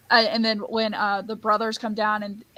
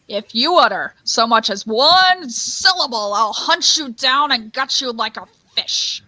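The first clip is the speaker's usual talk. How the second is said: loud, menacing rant with swooping stresses; theatrical threat; 6 dB louder than usual. Acted. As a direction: threaten with hammed-up fury. Performs a Grinch threat quote.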